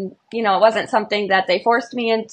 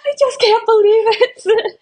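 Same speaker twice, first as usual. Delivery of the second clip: high, slow and choked up; overwhelmed tears; 13 semitones higher, 3 dB louder, slower than usual. Retold or imitated. Runner bawling to her boyfriend, overwhelmed tears. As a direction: let it spill out through tears. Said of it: "I was like".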